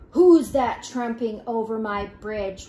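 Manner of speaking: loud troll challenge; roaring troll. A narrator's reading. Troll challenges the biggest goat, in a loud roar. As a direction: roar it out, hitting the first word hard. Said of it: "roared the troll".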